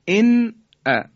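«ان ا» with a plainly pronounced n before the hamza; clear izhar. Shown as a demonstration. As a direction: sound the noon fully in «ان ا», then a clean break.

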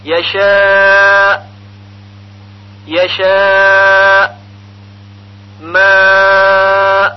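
یشاء with a long loud aa cut dead; pausal hamza. Shown as a demonstration. Teacher showing a pause on hamza, long vowel shut off cleanly in یشاء. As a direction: hold the alif, slow, stop without echo. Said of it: "در وقف بر همزه".

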